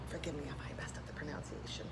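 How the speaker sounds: soft whispered aside; hushed apology. Real, not acted. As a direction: whisper it under your breath. Aside whispered during reading.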